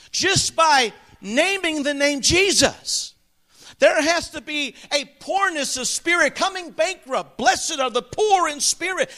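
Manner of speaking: impassioned pulpit appeal; fervent preaching. Real, not acted. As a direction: press the congregation with urgent conviction. Preaches with rising fervour.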